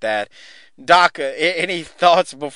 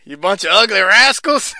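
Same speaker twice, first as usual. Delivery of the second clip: gruff mock-angry quote; quoted wrestling line; 6 semitones higher, 6 dB louder than usual. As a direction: sneer it like a heel. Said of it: "I love that line".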